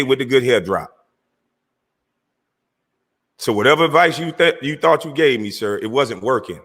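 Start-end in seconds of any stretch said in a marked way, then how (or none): none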